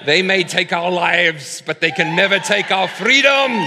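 hammed-up Scottish war cry; bad Scottish accent. Mel Gibson in war paint rallying troops, mock Scottish shout. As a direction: shout it in a badly faked Scottish accent, drawing out the words. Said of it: "I don't know how to do a Scottish accent".

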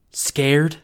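loud, incredulous bark; scornful father. A narrator's reading. Father scoffing at his scared child. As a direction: throw the word back at the kid. Dad mocking the fear.

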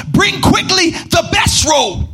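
high, slow commanding call as the father; father's command. Acted. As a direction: call for the best robe like an overjoyed father. Father's command, read theatrically.

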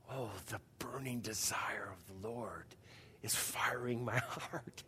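low, hushed, intense whisper; slow, soft, over-passionate whispering; miniature John Piper. Retold or imitated. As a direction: mimic an assistant copying his intense preacher. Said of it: "like seeing a miniature John Piper".

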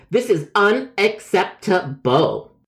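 "unacceptable" broken slowly into syllables, high pitched; indignant. Acted. Caller rejecting the agent's apology, "unacceptable" slow and syllable by syllable. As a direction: draw out "unacceptable" beat by beat in hammed-up outrage.

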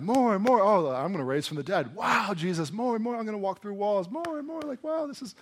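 high-pitched, hammed-up show excitement; mock dazzled audience. Acted dazzled Vegas show crowd.

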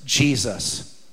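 a low, soft, hushed "Jesus"; hushed answer. Shown as a demonstration. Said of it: "Can I tell you what always works?".

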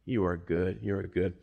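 low, hushed and tender; soft worship. Pretend. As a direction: murmur it like a quiet prayer. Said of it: "when you just say".

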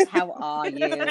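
mock London accent, high pitched. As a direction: imitate her London accent. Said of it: "Look how it sounds".

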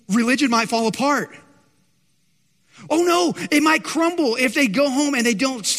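hammed-up dread of everything collapsing; gasp, then fret theatrically; mock panic. Mocks fear of religion collapsing.

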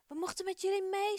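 high, bright-voiced happy news; beaming. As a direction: announce it glowing with joy.

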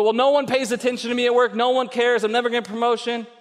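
a mock whining worker; whiny complainer. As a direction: rattle off the complaints. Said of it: "You go".

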